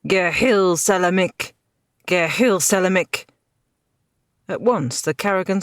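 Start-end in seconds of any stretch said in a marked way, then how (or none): 0.1-3.3 commanding shout. A narrator's reading. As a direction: call the order across the field. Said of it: "shouted loud in a commanding voice".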